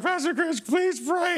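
high, whining plea; pleading boy. Retold or imitated. Injured boy in the hospital begging the pastor, high whine. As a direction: beg like a hurt kid.

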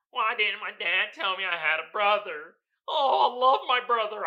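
hammed-up blubbering over a long-lost brother; mock crying. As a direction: fake sobbing, voice wobbling with tears. Mock tearful brother reunion.

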